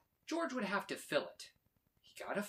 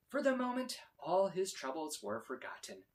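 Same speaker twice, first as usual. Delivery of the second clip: ordinary delivery, nothing marked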